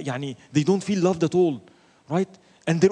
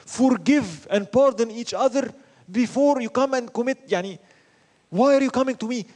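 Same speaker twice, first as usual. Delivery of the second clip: earnest urging; 7 semitones higher than usual. Retold or imitated. Prophet's words retold to companions.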